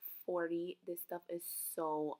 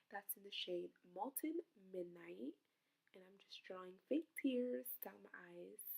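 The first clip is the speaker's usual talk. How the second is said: whispering; 6 dB quieter than usual. Real, not acted. Whispered aside while working.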